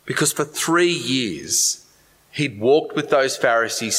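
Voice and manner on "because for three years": ordinary talk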